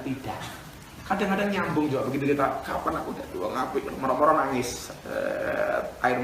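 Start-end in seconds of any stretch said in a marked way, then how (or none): 2.6-3.8 low, hushed heartfelt question; soft plea. Retold or imitated. Heart connects with Allah and tears come, soft plea. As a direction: say it softly, like talking to God.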